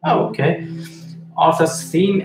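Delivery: normal talking voice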